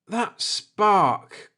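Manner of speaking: a bound man cursing his own blunder; groaning. Acted. Bound Akers realizing what wrecked him, groaning. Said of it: "groaned Akers".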